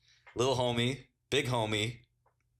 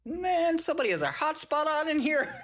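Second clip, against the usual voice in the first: high voice breaking into laughter; mock guard, much higher, laughing. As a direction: squeal it out mid-laugh.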